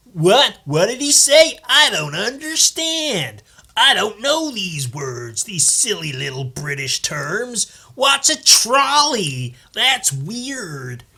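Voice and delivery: high, slow mock American voice; dumb American. Mocking American viewers, a high slow dopey voice. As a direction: ham up a clueless Yank. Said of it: "they'll go".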